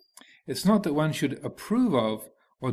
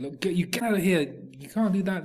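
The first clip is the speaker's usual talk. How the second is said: higher, affronted telling-off; more upset attendants; 5 semitones higher than usual. Acted. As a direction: drive the little imp away. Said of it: "they got even more upset and said".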